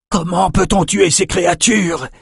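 high, urgent question thrown out in combat; urgent called-out question. A narrator's reading. Sorcerer to fellow sorcerer mid-battle, urgent high call. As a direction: call it out, pitched well up. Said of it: "lança Quentin à André".

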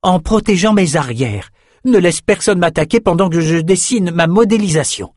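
confident sorceress urging her companion. Acted. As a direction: brush off his boasting and lead on. Elsa voiced by narrator.

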